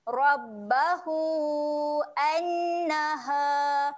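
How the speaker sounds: "annaha" with the alif held four beats; chanted with vowels held long. Shown as a demonstration. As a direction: stretch "Rabbahu" and "annaha" long. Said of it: "baca panjang empat harokat".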